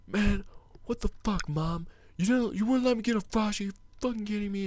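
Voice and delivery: high, whiny mock cussing, a kid throwing a fit; mock tantrum. Kid cussing out his mom over a Frosty, high whiny mock yell. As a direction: imitate the bratty kid yelling at mom. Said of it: "cuss out his mom".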